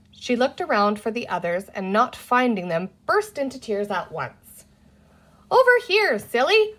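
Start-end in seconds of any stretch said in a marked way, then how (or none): 5.5-6.7 high, loud call across the river; higher, louder yell across the water; shouted. A narrator's reading. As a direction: shout to a lost little sister on the far bank. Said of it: "Penny shouted at her".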